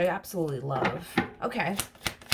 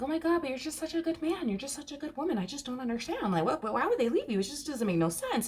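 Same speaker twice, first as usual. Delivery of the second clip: much higher and softer, wide-eyed disbelief; an infatuated partner, high and softer; gushing disbelief; 8 semitones higher, 3 dB quieter than usual. Retold or imitated. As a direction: marvel that anyone could leave such a catch. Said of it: "they're looking at them like".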